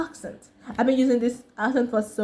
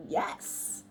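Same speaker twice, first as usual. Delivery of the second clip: whispered yes; 10 dB quieter than usual. Retold or imitated. Answering job offer.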